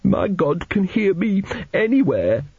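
put-on high, slow, devout child's voice; fervent boy. A narrator's reading. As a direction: declare it with a small boy's fervour. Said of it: "said Arthur fervently".

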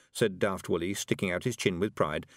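ordinary delivery

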